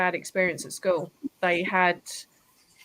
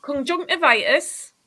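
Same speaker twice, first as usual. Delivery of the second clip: slow Geordie conjunctivitis, pitched up; Geordie word; 5 semitones higher, slower than usual. Shown as a demonstration. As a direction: Conjunctivitis drawn out slowly in a Geordie accent. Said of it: "I can do the odd word in Geordie".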